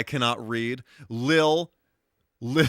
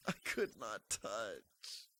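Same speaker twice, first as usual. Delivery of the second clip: much softer, slow trailing repeat; faint mutter; 2 semitones lower, 18 dB quieter, slower than usual. Real, not acted. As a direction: let it die away to nothing. Third take, near silent.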